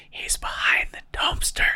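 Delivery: urgent gamer's stage whisper. Retold or imitated. Gamer to squad while wife sleeps, mock whisper. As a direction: whisper it covertly into a headset. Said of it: "whisper into the microphone".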